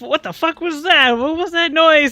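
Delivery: loud, high, startled outcry; startled awake. Acted. Game master voices sleepers jumping awake, loud startled outcry. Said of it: "you hear all these voices at once".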